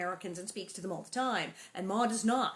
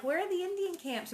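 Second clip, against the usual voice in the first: curious Laura; 10 semitones higher than usual. Retold or imitated. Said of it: "So Laura's like".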